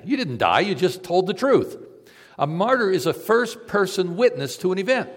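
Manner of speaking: high, loud sermon climax; impassioned preaching, building; preaching at full force. Acted. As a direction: drive the point home from the pulpit.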